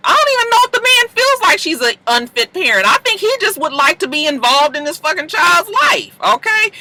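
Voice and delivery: high excited rant. Real, not acted.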